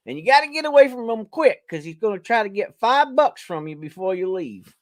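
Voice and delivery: normal talking voice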